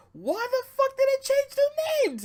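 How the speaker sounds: very high-pitched squawk of disbelief; outraged squeal. Retold or imitated. Retelling own fury at the renamed wrestlers, squealing outrage. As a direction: shriek it in exaggerated, squeaky outrage. Retold outburst at name change.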